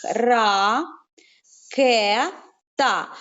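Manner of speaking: Ра, ке, та said as three separate syllables with pauses; syllable split. Shown as a demonstration. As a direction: break ракета into Ра, ке, та. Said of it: "три склади". (по складах)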